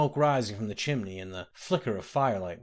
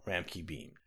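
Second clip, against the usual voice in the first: usual speaking voice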